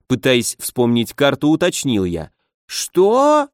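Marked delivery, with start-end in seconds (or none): 2.7-3.5 sharp, high indignant "what?"; outraged disbelief, pitched way up. Acted. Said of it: "возмутился".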